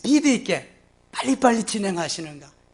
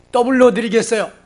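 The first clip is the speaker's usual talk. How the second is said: bright, louder eager pledge; eager offer; 5 semitones higher, 7 dB louder than usual. Retold or imitated. Believer eagerly offers double. (신나서 자랑스럽게 더블로 드리겠다고 말하기)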